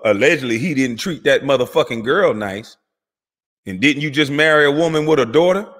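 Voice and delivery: usual speaking voice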